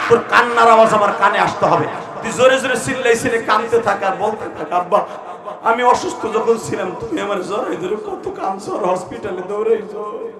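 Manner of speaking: loud, urgent exhortation; impassioned preaching. Real, not acted. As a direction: push them to weep aloud. Preaching at full force.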